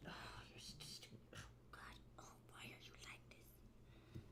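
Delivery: a fond exasperated whisper to the cat. Real, not acted. Whispering to the cat that blocks her tablet. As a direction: breathe it out, no voice at all.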